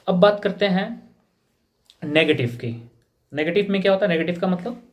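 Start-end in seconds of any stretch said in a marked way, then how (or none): none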